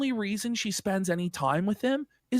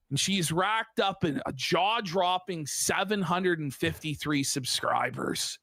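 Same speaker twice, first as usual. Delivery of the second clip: usual speaking voice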